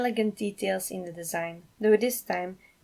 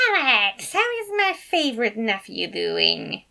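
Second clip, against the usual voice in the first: cheerful uncle; 3 semitones higher, 5 dB louder than usual. Acted. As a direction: greet your nephew with gusto. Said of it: "Remus cheered".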